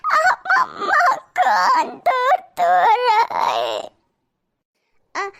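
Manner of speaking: put-on whiny child voice crying, slow. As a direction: play a little girl sobbing about her ear. (रोती हुई बच्ची की आवाज़)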